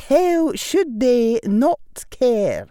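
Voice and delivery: high, loud, slow Scottish professor; precise Scottish accent. A narrator's reading. Professor reminding the boy he saved them, Scottish accent. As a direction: state it firmly in careful Scottish tones. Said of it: "in her precise Scottish accent".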